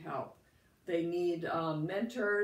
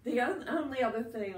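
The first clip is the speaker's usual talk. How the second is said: croaky voice; 5 semitones higher than usual. Real, not acted. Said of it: "I have a little bit of a cold".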